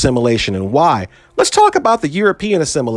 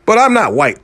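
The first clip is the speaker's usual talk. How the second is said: higher-pitched mock denial; Pete Davidson imitation; 6 semitones higher than usual. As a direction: imitate someone protesting, pitched up. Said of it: "but he can go".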